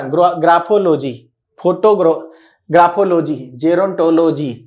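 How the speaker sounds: "ग्राफोलॉजी, gerontology" with full O vowels, "-o-LO-jee"; common mispronunciation. Shown as a demonstration. Teacher mimicking how many people say लॉजी, mispronounced with long O. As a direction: say "ग्राफोलॉजी" syllable by syllable as grapho-lo-jee. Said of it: "लॉजी जो बहुत सारे लोग बोलते हैं ना".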